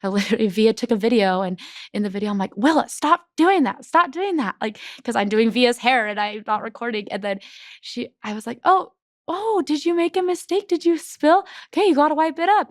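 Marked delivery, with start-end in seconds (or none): 2.6-4.5 higher, louder mock scold at a toddler; a retold stern "stop it" to a child. Mom scolding toddler in video. 8.6-12.7 put-on high, sing-song reassurance to a toddler; gentle mom voice. Mom gently coaching toddler after spill.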